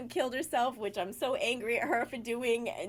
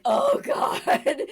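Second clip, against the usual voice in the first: loud, high groan of dismay; exasperated yell, pitched high; exasperated outburst; 9 dB louder than usual. Retold or imitated. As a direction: cry out in mock dread. Retold dismay at friend's request.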